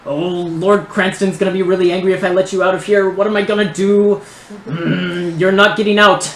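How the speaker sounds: high, loud nerdy fretting; nerdy voice shouting. Acted. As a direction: shout after them as a panicky high-pitched nerd. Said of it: "the voice just continues to shout".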